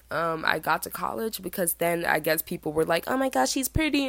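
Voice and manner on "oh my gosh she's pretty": higher-pitched imitation of admirers; mock gushing. People at college admiring her looks, mock gushing. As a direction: gush like an impressed onlooker.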